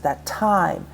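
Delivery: light "that", stretched stressed "time". Shown as a demonstration. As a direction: land firmly on "time" in "that time". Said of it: "notice how time is stressed".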